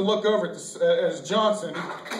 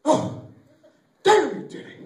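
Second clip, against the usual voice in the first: high, loud whoop of triumph; self-congratulating; 10 semitones higher, 6 dB louder, slower than usual. Acted. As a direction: cheer yourself on, hammed up. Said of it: "congratulate yourself".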